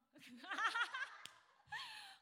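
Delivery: a high, loud, real burst of laughter. Real, not acted. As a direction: laugh out loud at the room's silence. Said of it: "有人吗？".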